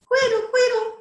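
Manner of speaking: high singsong bird call; imitated birdsong. A narrator's reading. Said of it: "Somewhere a bird sang".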